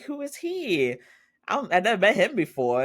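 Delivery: high, bright mock greeting from the mom; surprised mom. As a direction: squeak "Oh, hi" like a flustered mother. Voicing the mom asking about him.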